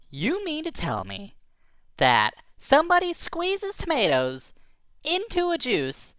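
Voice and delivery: high, slow and incredulous; acted astonishment, slowed right down; slow disbelief. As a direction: spell out the amazement phrase by phrase. Creature in disbelief at news.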